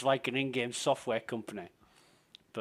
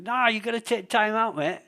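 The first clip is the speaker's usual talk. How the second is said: put-on much higher and louder Australian accent; hammed-up Aussie bloke urging him to relax; Australian mate; 8 semitones higher, 9 dB louder than usual. Australian mate telling him to chill and have a beer, loud Australian accent. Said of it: "my Australian mate Bushy".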